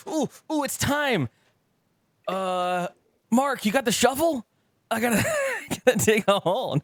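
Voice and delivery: high-pitched giddy mock voice; hammed-up high eager voice; mock eager self. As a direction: squeal it like an overexcited camper. Mocking himself eager to poop.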